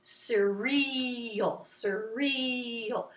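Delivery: 'surreal' with a long, drawn-out 'ree'; quick first syllable, hold the second; stretched middle syllable. Shown as a demonstration. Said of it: "if you want to do surreal".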